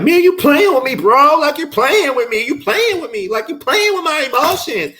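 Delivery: high, loud whiny mock complaint; hammed-up hurt girlfriend; imitating her. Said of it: "she was like".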